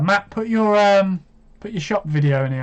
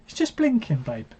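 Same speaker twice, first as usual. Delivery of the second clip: put-on much higher, dismissive retort to girlfriend; mock exasperated self; 8 semitones higher, 4 dB quieter than usual. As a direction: correct her like it's obvious, pitched way up. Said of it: "I'm like".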